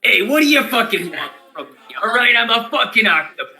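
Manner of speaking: put-on loud, brash New York cabbie accent; New York taxi driver. Octopus familiar snapping at the party, loud New York taxi driver accent. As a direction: gripe at the table like a Noo Yawk cabbie. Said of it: "It's still a New York taxi driver accent".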